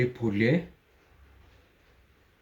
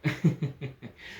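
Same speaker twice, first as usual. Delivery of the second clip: a short sinister laugh, falling away; creepy chuckle; 3 semitones higher than usual. Acted. Menacing man toys with her. (भीतीदायक हसू)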